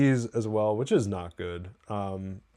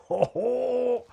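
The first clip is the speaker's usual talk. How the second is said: sudden high burst of laughter; 12 semitones higher than usual. Real, not acted. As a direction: cracks up high. Laugh after quote.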